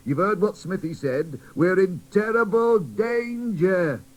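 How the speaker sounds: teddy bear leader addressing the toys, firm; blunt teddy bear. Acted. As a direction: speak plainly to the crowd.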